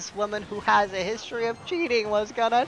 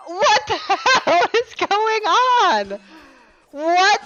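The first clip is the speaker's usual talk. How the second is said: much higher, much louder, slow laughing shock; laughing disbelief; 13 semitones higher, 9 dB louder, much slower than usual. Retold or imitated. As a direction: laugh through total bewilderment. Laughing at her bridge threat.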